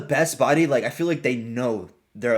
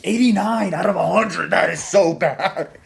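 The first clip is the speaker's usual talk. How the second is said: high, raised-voice disbelief; shocked outburst; 6 semitones higher, 4 dB louder than usual. Real, not acted. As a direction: blurt it out in shock, drawing out the end. Shocked reaction to a low rank.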